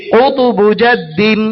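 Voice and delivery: কুতুবুজাদ্দিন stretched syllable by syllable, slow; slow recitation. Shown as a demonstration. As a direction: lead the class in slow chant. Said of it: "সবাই বলেন".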